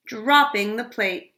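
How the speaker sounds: usual speaking voice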